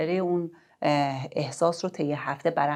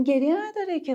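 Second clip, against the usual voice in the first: گریه نداره که brushed off, much higher and louder; dismissive parent; 12 semitones higher, 3 dB louder than usual. Shown as a demonstration. Parent dismissing a crying child, impatient and high.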